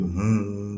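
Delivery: long, drawn-out "hmm"; imitated hum. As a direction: hum a wordless signal, held slowly. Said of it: "ایک آواز نکالی". (لمبی ہوں کی آواز)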